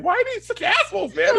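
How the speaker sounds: very high indignant wail; retold squeaky-high protest; exasperated outburst. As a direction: complain in hammed-up outrage. Acting own outraged reaction.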